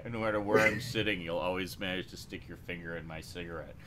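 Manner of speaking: normal talking voice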